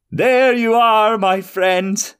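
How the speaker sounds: high, slow, cackling wizard voice; gleeful wizard exclaiming. A narrator's reading. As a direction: crow it out like a gloating villain. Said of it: "exclaimed Jekker".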